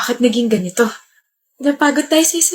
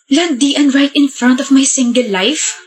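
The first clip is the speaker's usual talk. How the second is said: normal talking voice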